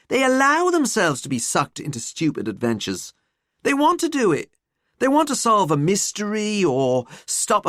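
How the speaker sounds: lively, scornful student voice; ranting student. Acted. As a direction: rant like an incredulous pupil. Student rants about adventurers.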